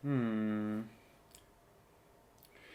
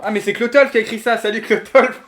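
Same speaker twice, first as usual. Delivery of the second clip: higher and louder, delighted hello; excited greeting; 6 semitones higher, 6 dB louder than usual. Real, not acted. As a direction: cheer a friend who just arrived. Greeting a friend arriving. (salut ravi, plus aigu et plus fort)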